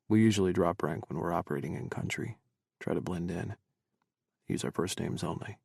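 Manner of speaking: low, quiet male-character voice; hushed, low sergeant; soft-spoken soldier David. A narrator's reading. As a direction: explain it quietly in a low register.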